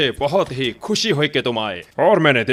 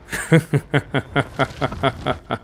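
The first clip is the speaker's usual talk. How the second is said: mocking laugh; 4 dB quieter than usual. Heimdall mocks the boy.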